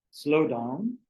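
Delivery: deliberate maxims with long pauses; guided meditation opening. Acted. Teacher settling listeners before an exercise, slow guided meditation. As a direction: give each short instruction room to sink in, slowly.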